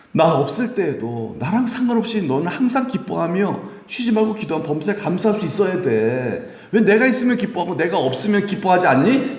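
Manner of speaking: voicing Paul. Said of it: "이렇게 말씀하신 겁니다".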